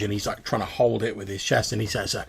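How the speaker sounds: ordinary talk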